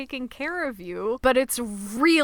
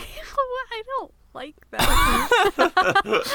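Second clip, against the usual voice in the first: high, slow, laughing shriek; laughing disgust; 12 semitones higher, 4 dB louder, much slower than usual. Real, not acted. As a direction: squeal it out through laughter. Disgusted at the ASMR bit.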